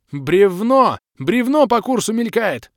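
loud, high panicked scream; frantic yell. Acted. Hero screaming a warning about the falling log, loud and high. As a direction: scream the warning at full pitch. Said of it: "Истошно заорал я".

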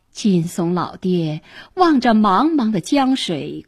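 ordinary delivery

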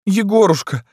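high, loud wailing call; tearful girl. A narrator's reading. Wounded Lyuska calls to Yegor, weeping. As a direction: cry out in a high girlish voice. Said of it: "заплакала Люська".

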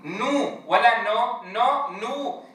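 نو held very slow; teacher drilling vowel length; drawn-out syllable drill. Shown as a demonstration. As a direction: stretch نو long each time. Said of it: "إتمام الحركات".

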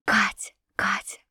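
breathless tender whisper; whispering the princess's name. A narrator's reading. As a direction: whisper her name lovingly.

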